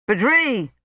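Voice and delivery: mild narrated shout; a light calling shout; shouted name. A narrator's reading. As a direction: call out across the snow, lightly. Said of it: "he shouted".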